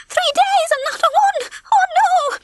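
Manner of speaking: very high, fretful wail; panicking. A narrator's reading. Babs confesses to having no eggs, panicking. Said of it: "Babs was panicking".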